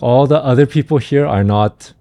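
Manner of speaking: usual speaking voice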